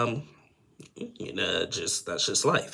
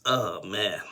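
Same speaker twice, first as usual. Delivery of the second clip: ordinary delivery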